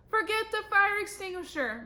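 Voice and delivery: high, urgent yell. A narrator's reading. Principal as the banner burns, yelling. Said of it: "Mister Klutz yelled".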